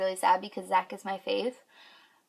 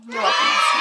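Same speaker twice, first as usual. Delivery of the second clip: mock shout; 8 dB louder than usual. Real, not acted. Mock address to Weston.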